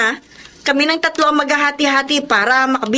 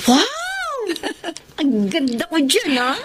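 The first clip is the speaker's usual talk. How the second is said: delighted; 5 semitones higher, 4 dB quieter, slower than usual. Acted. Sees her framed photo.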